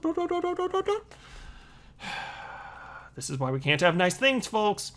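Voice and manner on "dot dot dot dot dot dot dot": dots held very high and light; high mock suspense. Shown as a demonstration. Reading the headline's ellipsis to viewers, high teasing sing-song. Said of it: "the inflection in my voice goes up".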